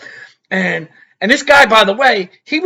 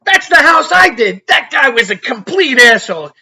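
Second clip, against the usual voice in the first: higher, louder, half-shouted admission; retold outburst; 6 semitones higher, 6 dB louder than usual. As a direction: blurt it out proudly. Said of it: "I said".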